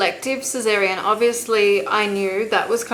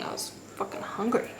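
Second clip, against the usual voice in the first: breathy whispered confession, much softer; whispered aside; 2 semitones higher, 9 dB quieter than usual. Real, not acted. Mother admitting she ate before labour, whispered aside. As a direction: drop to a half-whisper for the guilty admission.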